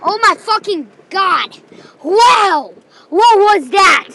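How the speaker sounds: loud, startled exclaiming after a fright; rattled and loud; shocked outcry. Real, not acted. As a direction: cry out in real shock. Shocked by thunder clap.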